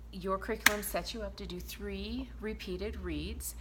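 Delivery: ordinary delivery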